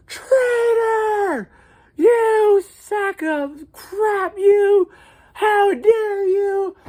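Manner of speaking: high, loud hammed-up screaming of insults; mock angry scream. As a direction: fake outrage yelled out a car window. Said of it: "we're screaming at each other".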